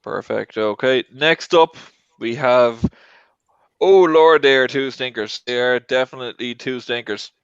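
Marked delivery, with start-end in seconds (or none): none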